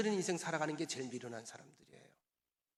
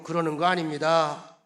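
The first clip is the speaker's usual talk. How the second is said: much louder, emphatic call-out; 9 dB louder than usual. Shown as a demonstration. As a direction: lead the congregation loudly. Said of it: "큰 소리로".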